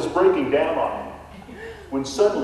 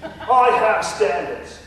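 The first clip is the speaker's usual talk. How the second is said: wailing, much higher and louder fake English lament; mock English accent; 7 semitones higher, 4 dB louder than usual. Ghost Nigel wails to the realtor in a fake English accent. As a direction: ham up a despairing English ghost. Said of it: "a Texan trying to emulate an Englishman".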